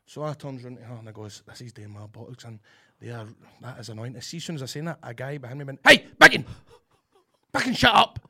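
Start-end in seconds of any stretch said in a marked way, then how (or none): 1.5-4.1 low, soft grumble; muttered annoyance. Retold or imitated. Muttered complaint retold from cinema. 5.8-8.2 loud, high heckle from the row behind; acted angry shout. Man behind shouting at him.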